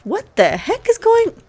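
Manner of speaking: high, loud shocked cry; startled outcry. Real, not acted. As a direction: blurt it out in sudden shock. Sudden shock at the ceiling.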